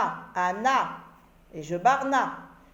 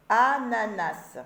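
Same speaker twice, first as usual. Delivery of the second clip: ANANAS drawn out slowly, syllables set apart; slower than usual. Shown as a demonstration. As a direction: stretch "ananas" slowly for learners to hear each syllable. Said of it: "je commence par la première syllabe".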